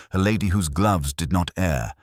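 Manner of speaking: ordinary talk, nothing marked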